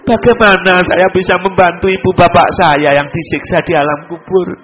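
rising, imploring preacher's voice; pleading sermon. Acted. Preacher crying out to God for his suffering parents, imploring sermon. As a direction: beg for help as if from hell on earth.

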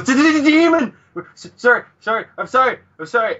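high, stuttering panic into frantic apologies; mock terrified scream. Shinpachi sees a creepy face, mock terrified screaming. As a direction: stammer a shriek, then gabble apologies. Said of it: "then he screams his face off".

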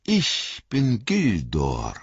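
slow, stately elf lord. A narrator's reading. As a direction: introduce yourself with calm dignity. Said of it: "antwortete ihr Anführer".

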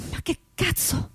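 breathless whispered curse, high; startled whisper. Acted. Reporter waking confused, whispered startled curse. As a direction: gasp it out in shock.

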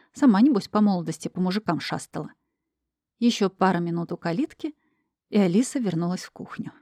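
ordinary delivery, nothing marked

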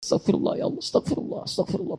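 breathy whispered plea; whispered istighfar. Shown as a demonstration. Preacher whispering the istighfar as if death were near, hushed. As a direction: whisper "astagfirullah" under your breath. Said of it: "Bayangkan kalau maut datang".

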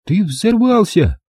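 put-on high, slow, stunned girl's voice. A narrator's reading. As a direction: spell it out to him in disbelief. Angela insists, astonished. (Анжела изумлённо настаивает, высоким голосом)

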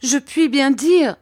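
weeping wife protests to her husband; tearful plea. A narrator's reading. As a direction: plead through tears. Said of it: "qui pleurait à chaudes larmes".

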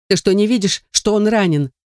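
high, agitated outburst; hysterical exclamation. A narrator's reading. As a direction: exclaim it in rising panic, without shouting. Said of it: "истерично воскликнула я".

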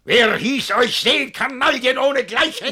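high, loud berating; furious shout. Acted. Master berates his servants, furious shout. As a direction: tear into them.

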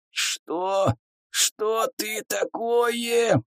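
high, slow, hushed hoarse demon voice; terrified demon rasp. A narrator's reading. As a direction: rasp it out like a cornered monster. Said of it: "прохрипел демон в ужасе".

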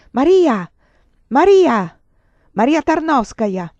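the haunting cry through the bedclothes; repeated shout. Acted. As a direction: keep crying out her name. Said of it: "ma quel grido".

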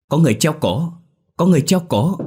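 high, loud frightened cry; panicked shout. A narrator's reading. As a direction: scream it out in shock. Boys discover a hanged body.

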